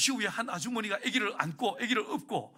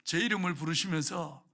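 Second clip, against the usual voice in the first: ordinary talk, nothing marked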